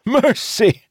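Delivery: high, disbelieving cry; anguished wail. A narrator's reading. Restrained captain throwing the word at the commander, wailing. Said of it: "Idara wailed".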